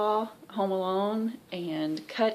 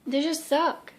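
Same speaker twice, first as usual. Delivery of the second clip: emotional; 8 semitones higher than usual. Real, not acted. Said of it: "Trying not to get emotional".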